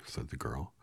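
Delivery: ordinary delivery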